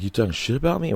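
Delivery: high, imitated aggressive question; mock threatening challenge; mock angry accusation. Aggressor voiced in story.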